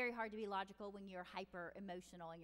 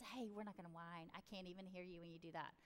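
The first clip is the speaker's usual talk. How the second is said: low, soft, matter-of-fact correction; low-key discipline; 5 semitones lower, 7 dB quieter than usual. Acted. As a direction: keep it calm and quiet, no big deal. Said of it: "big discipline, just".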